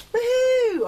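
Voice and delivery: loud, high "woohoo"; high whoop. Acted. Whooping over a Mary Poppins record, high and loud. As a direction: let out a big high whoop.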